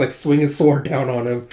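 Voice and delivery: ordinary talk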